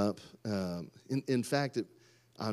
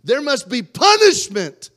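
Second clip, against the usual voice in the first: mock-righteous decree; 9 semitones higher, 11 dB louder than usual. Preacher playing the embarrassed parent demanding punishment, thundering. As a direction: thunder the sentence like a verdict.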